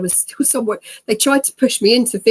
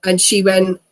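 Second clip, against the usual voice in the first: ordinary talk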